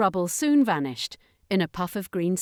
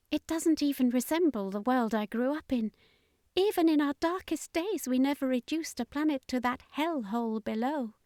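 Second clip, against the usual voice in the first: dismayed civilian woman; 7 semitones higher, 3 dB quieter than usual. Acted.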